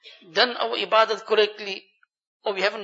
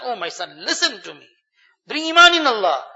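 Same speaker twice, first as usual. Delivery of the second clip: retold shout; 2 semitones higher, 7 dB louder than usual. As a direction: call out to a son, rising to full volume on the last phrase. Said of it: "is shouting to his son".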